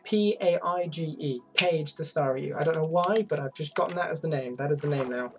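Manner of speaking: ordinary talk, nothing marked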